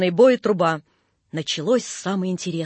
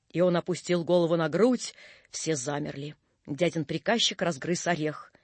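plain reading voice

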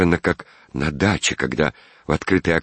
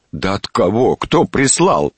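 louder, higher insistent questions; mounting impatience, voice raised; impatient demand; 5 semitones higher, 4 dB louder, slower than usual. A narrator's reading. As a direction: press hard for an answer. Master presses servant for an answer.

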